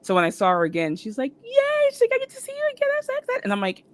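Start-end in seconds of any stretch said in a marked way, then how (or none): 1.4-2.1 high-pitched little squeal; excited cheer. Retold or imitated. Star seeing the fan again for a picture, excited. 2.1-3.4 very high, light gush; excited gushing. Retold or imitated. Star seeing the fan again for a picture, excited.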